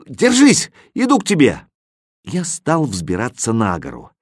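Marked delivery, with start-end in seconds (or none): 0.1-1.7 high, loud encouraging shout; shout to the cat. A narrator's reading. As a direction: call out to rescue him.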